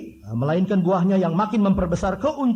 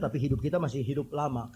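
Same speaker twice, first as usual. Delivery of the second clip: ordinary delivery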